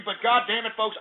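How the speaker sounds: ordinary talk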